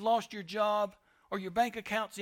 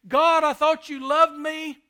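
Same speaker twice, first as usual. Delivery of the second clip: higher and much louder, a pained cry; anguished plea to God; 7 semitones higher, 13 dB louder than usual. Acted. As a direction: cry out to God in hurt disbelief. Believer crying out to God.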